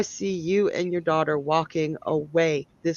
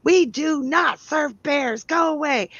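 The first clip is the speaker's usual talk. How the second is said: a lady shrieking at a bear, hammed up; imitating an outraged woman screaming; mock screaming lady; 7 semitones higher than usual. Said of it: "she's screaming".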